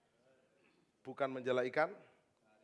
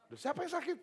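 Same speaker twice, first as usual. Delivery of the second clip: high, louder, fed-up question; irritated pastor; 5 dB louder than usual. Retold or imitated. Pastor reaching the cow shed, high and exasperated. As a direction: let the pastor's patience snap upward. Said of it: "dia agak marah".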